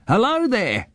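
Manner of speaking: put-on high-pitched quirky old man; old man greeting. A narrator's reading. Odd old man greets two children across a stream, high character voice. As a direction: call hello in a high old man's voice.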